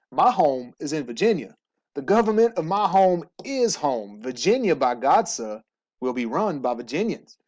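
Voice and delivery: a hammed-up Southern gentleman accent; playful Southern accent, proud Virginian. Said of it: "that was my best Southern accent".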